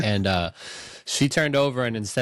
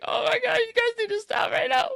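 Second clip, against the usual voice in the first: crying shout. Retold or imitated. Fan reenacts bawling at the movie to his cohost, high sobbing shout. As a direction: wail it like you're bawling in the theater. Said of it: "Bro, I was like".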